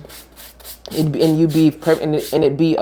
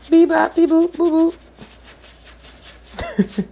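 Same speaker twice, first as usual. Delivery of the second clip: much higher, robotic beep boop noises; 13 semitones higher, slower than usual. Pretend. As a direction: chirp them like a little robot. Imitated machine noises.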